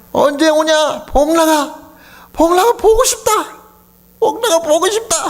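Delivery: put-on elderly father on the phone longing for his son, high and aching; longing old father. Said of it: "저를 정말 마지막에 제일 아프게 한 말씀".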